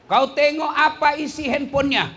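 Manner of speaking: preacher urging parents to check the phone, loud and high; emphatic loud repeat. Shown as a demonstration. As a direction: repeat it with full force.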